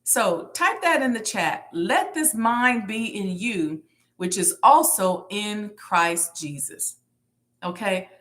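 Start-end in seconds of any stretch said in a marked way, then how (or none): 1.7-7.0 slow recitation, press on Let; stress on Let. Shown as a demonstration. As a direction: Let leaned on, whole verse slow. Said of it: "The emphasis on the word let".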